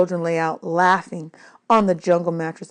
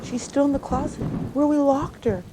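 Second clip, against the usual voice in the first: horrified; 8 semitones higher than usual. Acted. Child realizing the horror.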